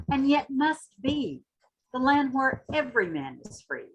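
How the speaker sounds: rising, impassioned spoken-word reading; dramatic poetry reading with swelling intensity; poem recitation. Acted. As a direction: recite it like a rallying cry, building toward the end. Recites Hughes poem after introduction.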